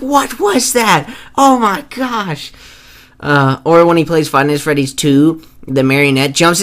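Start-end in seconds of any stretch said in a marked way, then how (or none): 0.0-2.5 high, slow, drawn-out shock; hammed-up high gasping; imitating Markiplier. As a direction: stretch it out in mock horror. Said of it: "He's like".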